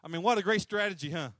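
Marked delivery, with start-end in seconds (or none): none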